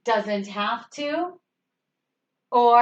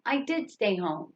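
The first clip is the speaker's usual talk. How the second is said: softer, with emphasis on "did"; stress on "did"; 4 semitones lower, 7 dB quieter than usual. Shown as a demonstration. Teacher repeating the earlier example, emphasis on "did". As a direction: make "did" the strong word. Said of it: "like what we did with did".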